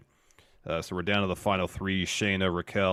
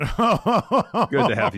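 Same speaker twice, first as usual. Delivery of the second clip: slow, high, loud through real laughter; can barely get it out for laughing; 12 semitones higher, 8 dB louder, much slower than usual. Real, not acted. Co-host cracks up after the burn.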